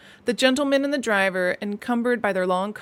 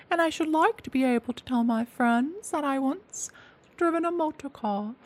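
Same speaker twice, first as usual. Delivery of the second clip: high, quick pleading lady's voice; eager washerwoman voice; 5 semitones higher, 3 dB quieter than usual. A narrator's reading. Toad begging the driver for a turn at the wheel, high and eager. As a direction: coax the driver in a put-on high voice.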